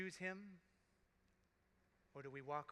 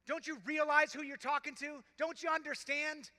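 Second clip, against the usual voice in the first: loud, higher urgent scolding; the second criminal rebuking the first, loud and urgent; rebuke; 9 semitones higher, 13 dB louder than usual. Acted. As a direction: scold him hard, pleading and stern at once. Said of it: "he rebukes the other criminal".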